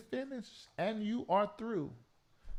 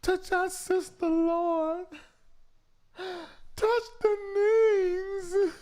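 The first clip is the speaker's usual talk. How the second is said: wailing prayer; 9 semitones higher, much slower than usual. Retold or imitated. Prayer line callers wailing to the Lord, mock wailing. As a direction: imitate a crying prayer warrior. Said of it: "Don't you lie and say you ain't said".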